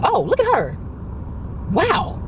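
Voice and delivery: imitated amazement, voice pitched high; high, drawn-out wonder as an onlooker; shocked reaction. Officer watching the video of the worker, shocked.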